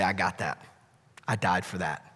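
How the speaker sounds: soft, low, tender reassurance; gentle Jesus. Acted. Jesus reassures the accused believer, soft and low. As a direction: quietly comfort the guilty one.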